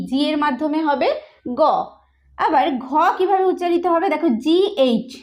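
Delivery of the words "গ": গ said alone as hard G; G's sound গ, isolated; letter-sound demonstration. Shown as a demonstration. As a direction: sound out গ after a pause. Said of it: "গ উচ্চারণ হয় জি".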